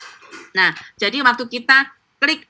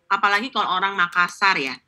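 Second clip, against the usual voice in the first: ordinary talk, nothing marked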